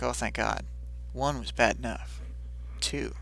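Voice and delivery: soft, understated relieved aside; muted relief. Retold or imitated. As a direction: voice Taylor's relief, quietly. Acts Taylor's weary relief.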